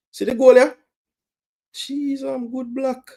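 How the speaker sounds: high, loud burst of excitement; real fan excitement; excited yelp. Real, not acted. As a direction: leap up watching the chance. Excited fan watching a chance.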